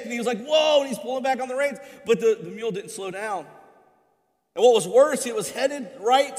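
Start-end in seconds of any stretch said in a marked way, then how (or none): 0.4-0.8 loud, high panicked whoa; panicked yell. Retold or imitated. Rider shouting at a bolting mule, panicked and loud. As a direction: rider yelling at a runaway mule. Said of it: "And so he was real panicked".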